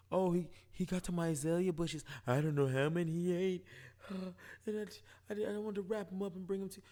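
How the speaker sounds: high, put-on fussy neighbour voice; imitating flustered Agnes explaining the dog; Agnes impression.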